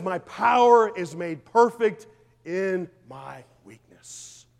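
slow, loud, each word weighed, power stressed; slow emphatic scripture. Acted. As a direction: lean hard on every word.

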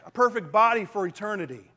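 normal talking voice

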